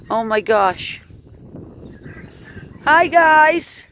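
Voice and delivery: normal talking voice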